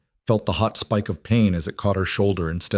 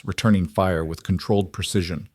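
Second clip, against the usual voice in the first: ordinary delivery, nothing marked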